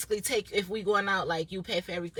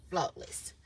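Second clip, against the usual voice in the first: ordinary talk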